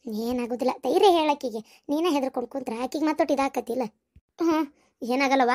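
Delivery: quietly lend them courage; calm reassurance. Acted. Mother-in-law urging a frightened mother to be brave, soothing.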